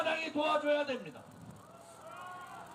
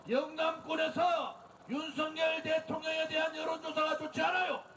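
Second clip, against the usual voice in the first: normal talking voice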